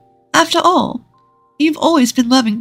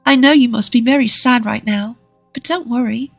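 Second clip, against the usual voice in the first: put-on high, gentle woman's voice for children; 6 semitones higher than usual. A narrator's reading. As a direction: reassure two little girls in a gentle, higher voice. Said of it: "Lisa spoke to Molly and Clara in a gentle voice".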